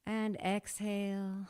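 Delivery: slow, long "exhale"; drawn-out exhale cue. Acted. Teacher cueing the exhale slowly. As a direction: stretch the cue like a breath.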